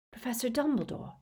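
high, tense call; worried, strained appeal. A narrator's reading. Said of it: "his voice strained".

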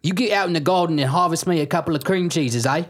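high put-on British dad voice; hammed-up accented father; bossy dad. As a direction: order the kid outside in a silly accent. Skit: father voice.

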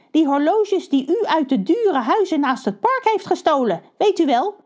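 high, eager little crow voices; young crows. Acted. Young crows reminding their uncle about the stolen watches, high and eager. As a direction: chirp it up high and keen.

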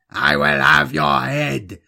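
loud, anguished warlord's cry; wailed threat. A narrator's reading. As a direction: wail the threat with force. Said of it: "Talfa wailed".

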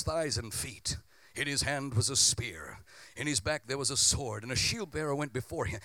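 low, measured Bible reading; scripture reading. Acted. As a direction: read the passage solemnly. Reading Goliath scripture aloud.

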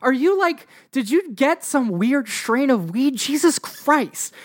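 high, exasperated woman's voice; incredulous woman brushing him off. Acted. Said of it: "And the woman is like".

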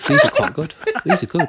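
proud and cracking up; real laughter while boasting; laughing brag. Real, not acted. Showing off cleavage, laughing.

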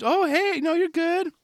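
high, soothing mock parent; reassuring parent. Parent reassuring a fallen kid, high mock soothing. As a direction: coax a fallen kid not to cry. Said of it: "you try to beat him".

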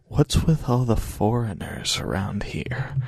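whispered aside. Acted. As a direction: whisper it to your friend. Leaning over to Jack.

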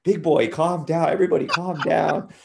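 high, loud imitation of a dad breaking up a fight; old dad. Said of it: "He's, like, old".

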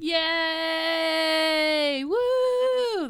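high whooping audience cheer. Retold or imitated. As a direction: cheer like a sitcom crowd. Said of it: "That's the audience".

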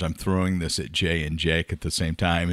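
usual speaking voice

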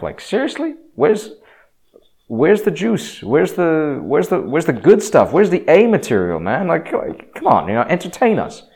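high-pitched, scoffing heckler demanding better material; mocking incredulity. Greeks ridiculing the risen God story, incredulous and mocking. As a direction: play the Greeks finding it all ridiculous, voice riding high. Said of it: "found the entire thing ridiculous. They were like".

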